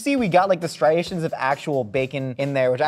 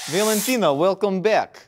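higher, hammed-up Russian accent; terrible Russian accent; 3 semitones higher, slower than usual. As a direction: put on a bad Russian accent. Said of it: "doing the Russian accent".

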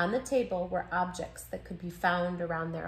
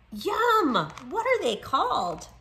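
put-on excited child voice; 7 semitones higher, 4 dB louder than usual.

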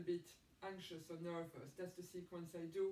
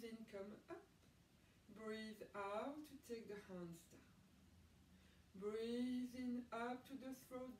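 calm, even yoga teacher voice; guided breathing instruction; 4 semitones higher than usual. Acted. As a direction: talk students gently through each breath.

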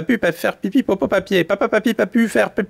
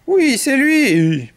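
higher, drawn-out slow chant; chorus of accusers; 5 semitones higher, much slower than usual. Acted. Animals blame the canary together, high slow chorus. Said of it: "reprennent en chœur le hibou".

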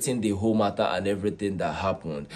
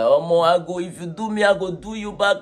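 higher, louder mock threat; 8 semitones higher, 5 dB louder than usual. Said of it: "Portable was like".

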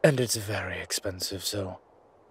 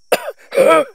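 a violent, high hacking cough; harsh cough; 15 dB louder than usual. Acted. Choking on holy water mist, he coughs violently. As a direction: hack up a lung from the poisoned air.

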